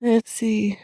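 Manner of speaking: softly whispered to herself. Real, not acted. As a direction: breathe it out while looking something up. Streamer muttering while scrolling.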